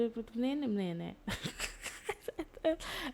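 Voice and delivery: real laugh breaking out mid-story; helpless amused laughter; breathy laugh. Real, not acted. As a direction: laugh at the absurd plot.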